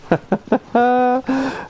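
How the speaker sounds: high-pitched burst of real laughter. Real, not acted. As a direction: crack up high. Laughing at chat joke.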